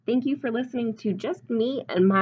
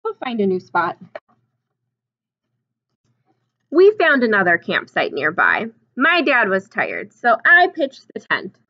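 storybook reading; 8 dB louder than usual. A narrator's reading. Reads picture book aloud.

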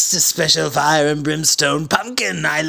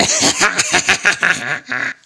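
maniacal laugh; 7 semitones higher than usual. Acted. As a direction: shriek with victorious laughter. Goblin villain laughing.

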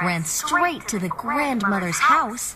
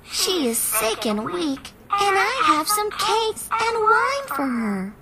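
put-on little girl voice; 10 semitones higher than usual. A narrator's reading. Little Red answers the wolf.

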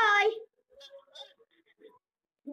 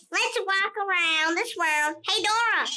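normal talking voice

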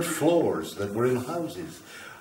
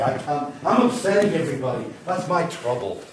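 usual speaking voice

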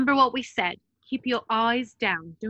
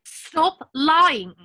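angry demand; 5 semitones higher, 8 dB louder than usual. Acted. Hermione confronting Mundungus.

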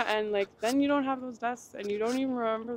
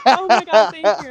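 louder, gushing thank-you; relieved thanks; 12 dB louder than usual. Retold or imitated. Retelling her rescue by neighbors, loud gushing relief. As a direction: burst out with grateful relief.

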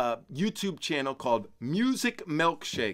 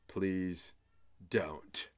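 whispered aside; 6 semitones lower, 10 dB quieter than usual. Acted. Joke about not inspiring kids.

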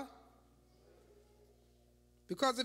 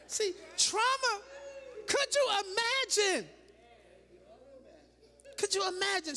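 preaching shout; 7 semitones higher than usual. Real, not acted. Preacher shouting about trauma.

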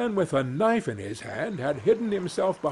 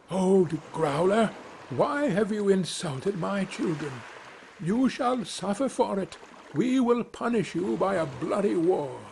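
raised, lightly voiced king declaring war; a small king scolding a bear, a bit higher; a hint of a wrathful bird king; 6 semitones higher than usual. The wren king calling into the bear's cave, lightly raised and stern.

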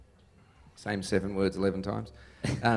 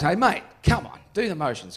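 raised-voice urging from the stage; calling out; 7 semitones higher, 4 dB louder than usual. Retold or imitated. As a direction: prod a man down the front. Calls to man in crowd.

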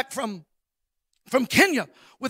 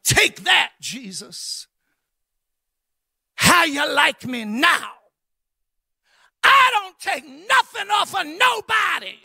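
defiant taunt; 4 semitones higher than usual. Retold or imitated. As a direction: fire it back like a jab. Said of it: "in a retaliatory fashion".

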